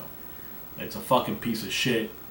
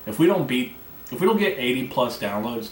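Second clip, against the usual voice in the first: normal talking voice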